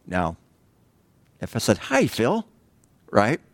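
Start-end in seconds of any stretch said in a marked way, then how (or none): 1.8-2.5 bright, high, welcoming hi; inviting greeting. Shown as a demonstration. Preacher greeting Phil warmly, high and inviting. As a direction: greet him like you're glad to see him. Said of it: "is much different than".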